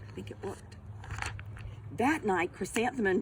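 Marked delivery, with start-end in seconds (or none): 0.1-0.8 whispered aside to listeners. Real, not acted. Reader whispers to children that it worked. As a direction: breathe it as a secret. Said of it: "aside to listeners".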